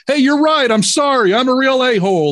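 high, squeaky mock apology from the other driver; a hammed-up sorry-driver voice; mock apologetic driver. Mocking the other driver's fake apology, high and squeaky. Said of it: "and be like".

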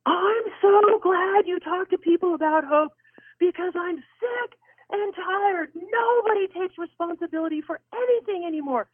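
high, heated mock yell. Fed-up cashier yelling in a customer's face. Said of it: "starts to yell in my face".

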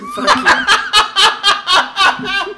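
loud laugh. Real, not acted. Big burst of laughter.

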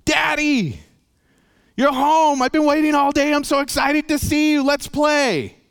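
put-on high, loud squealing little boy; over-the-top excited child voice; excited five-year-old. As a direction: burst out like a kid thrilled Dad's home. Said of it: "His five-year-old son".

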